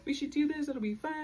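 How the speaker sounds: high, bright hyped-up voice; excited quote. Retold or imitated. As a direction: gush like you're pitching a fun plan. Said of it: "I, like, told them".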